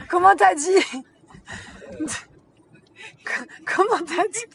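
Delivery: high, loud, laughing questions; laughing hard. Real, not acted. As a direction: crack up while asking. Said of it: "je pleure de rire".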